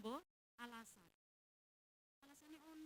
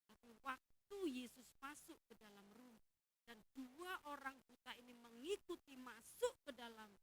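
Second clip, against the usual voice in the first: ordinary talk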